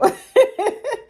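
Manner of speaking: high embarrassed laugh. Real, not acted. Embarrassed laugh about weight.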